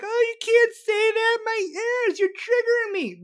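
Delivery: high, loud whiny mockery of a snowflake; mock triggered whiner. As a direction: squeal it like someone offended. Mocking oversensitive people.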